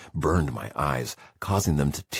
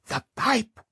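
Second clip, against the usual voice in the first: put-on high, pressing cry of an old man; sharp urgent call. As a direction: snap it out to get him moving. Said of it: "Dan said again".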